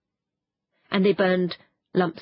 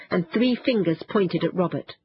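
plain reading voice

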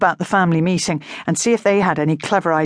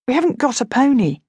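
usual speaking voice

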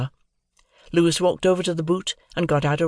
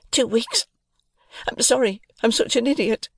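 put-on high, timid young woman; sweet, shy plea for help; 10 semitones higher than usual.

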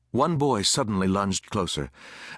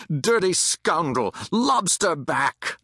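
high, slow, drawn-out jeers; shouted curses; 6 semitones higher, slower than usual. A narrator's reading. Mob cursing a lone soldier, shouting. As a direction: stretch each insult into a yell. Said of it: "the curses rose once more".

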